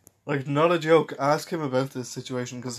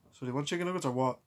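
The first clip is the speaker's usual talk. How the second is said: fast impatient cashier; 6 dB quieter, much faster than usual. Retold or imitated. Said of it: "the man behind the counter goes".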